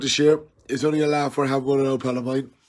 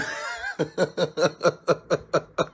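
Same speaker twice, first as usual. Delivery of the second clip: laughter; 6 dB quieter than usual. Real, not acted. Laughing at pal Tom after the thumbs down jibe, giggly. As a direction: laugh it off at your mate. Laughing at his own joke.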